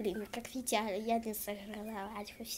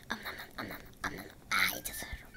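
much lower growly munching monster; hungry monster voice; much faster than usual. Pretend. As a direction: devour them, low and hungry. Playing a hungry wolf.

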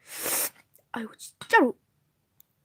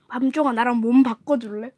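ordinary delivery

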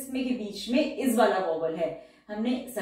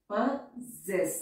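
Possess drawn out very slowly, both syllables stretched; slow dictation; 3 dB quieter, much slower than usual. Shown as a demonstration. Teacher dictating possess very slowly to her students. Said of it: "लेकिन word है possess".

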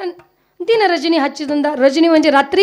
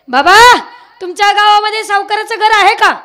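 put-on loud, higher hail to the old man; calling out; 5 semitones higher, 7 dB louder than usual. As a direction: call across to get his attention. Thieves call out to the saint.

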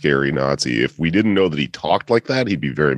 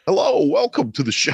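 attempted Walken impression; 10 semitones higher than usual. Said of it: "I tried to do Walken".

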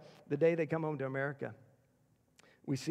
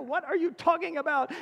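exasperated; 11 semitones higher, 5 dB louder than usual. Retold or imitated. Baffled dad answers screaming child.

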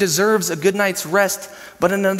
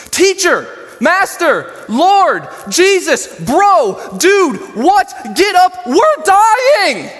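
high, loud shouts, one name after another; panicked screaming; 12 semitones higher, 7 dB louder, much slower than usual. Acted. Disciples on a sinking boat waking Jesus, panicked screams. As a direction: scream anything that might wake him. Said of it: "panicked so bad they are screaming anything".

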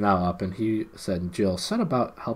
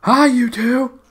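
much higher, louder call to wake the sleepers; loud shout; 11 dB louder, much slower than usual. A narrator's reading. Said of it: "shouted Jill in a loud voice".

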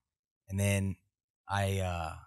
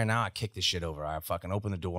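ordinary talk

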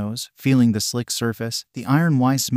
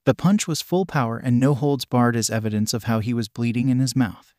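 ordinary delivery

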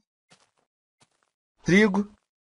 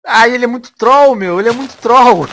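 loud, raised-pitch complaint; exasperated outburst; 4 semitones higher, 8 dB louder than usual. Real, not acted. Player trolled by the unicorn, loud exasperation. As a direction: complain loudly at being trolled.

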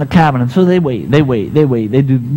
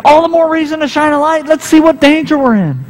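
urgent insisting; 11 semitones higher than usual. Acted. First man insists on light.

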